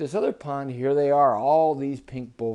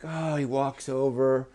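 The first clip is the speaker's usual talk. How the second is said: ordinary delivery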